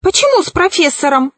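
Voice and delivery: high, quick startled question; exclaiming. A narrator's reading. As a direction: blurt it out fast and high. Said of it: "воскликнул колдун".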